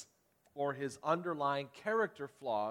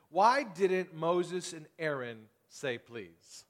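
slow question; much slower than usual. Real, not acted. Preacher poses pointed question.

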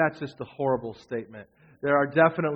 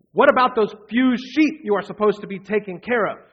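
scornful brother; 5 semitones higher, 7 dB louder than usual. A narrator's reading. Brother's retort read aloud.

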